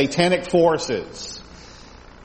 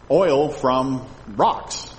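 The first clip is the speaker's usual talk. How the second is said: "oil from rocks" said very slowly; slow, drawn out; much slower than usual. Shown as a demonstration. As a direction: spell out the meaning word by word. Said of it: "slow explanation".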